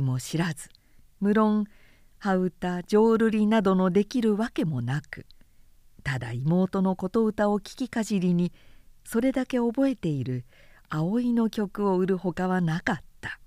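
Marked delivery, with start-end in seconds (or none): none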